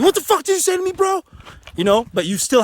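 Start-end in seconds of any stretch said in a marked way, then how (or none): 0.0-1.2 high, loud furious yell; aggressive yell. Shown as a demonstration. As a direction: explode at them, high and loud. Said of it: "you don't have to be".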